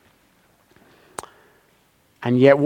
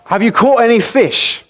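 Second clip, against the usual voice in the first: calling out; 7 semitones higher than usual. A narrator's reading. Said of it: "he calls out to them".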